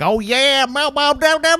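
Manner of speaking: cartoon Southern voice mocking a cliché; mock Southern twang. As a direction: parody a Southern yokel. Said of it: "when people talk about the South".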